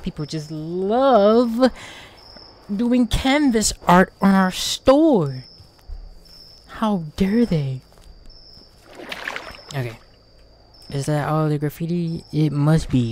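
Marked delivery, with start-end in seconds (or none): 0.0-7.8 slow high sarcastic indignation; exaggerated slow disdain; mock outrage. Real, not acted. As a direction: drawl it like an offended shopkeeper.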